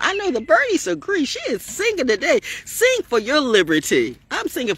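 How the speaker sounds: very high, defiant outburst; defiant squeal. Real, not acted. Mocks invitation, exclaims defiantly.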